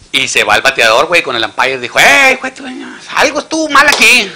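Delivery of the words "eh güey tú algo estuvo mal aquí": high, loud protesting batter; indignant complaint. Acted. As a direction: protest hard to the umpire. Bateador reclama al ampáyer. (reclamo indignado del bateador, agudo y fuerte)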